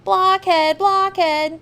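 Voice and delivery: mock him like schoolyard kids; taunting children. A narrator's reading. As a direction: classmates taunting the boy, jeering. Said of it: "they cried".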